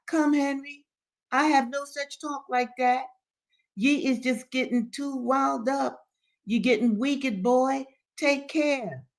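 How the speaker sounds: put-on high, preachy old man; warning Daddy Joe. A narrator's reading. Old man warns Henry with scripture, reproving. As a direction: caution him in a high-pitched pious voice.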